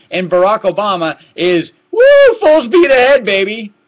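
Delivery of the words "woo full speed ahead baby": high, loud, slow whooping; gleeful mock cheer. Mocking Obama speeding toward the wall, high loud whoop. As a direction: whoop it like a reckless driver flooring it.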